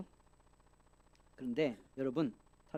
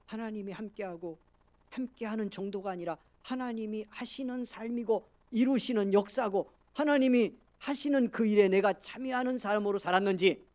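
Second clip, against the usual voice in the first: raised-pitch preaching, swelling louder; impassioned exhortation; 8 semitones higher, 8 dB louder than usual. Real, not acted. Preacher urging believers to examine their week, impassioned exhortation. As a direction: press the question on the congregation.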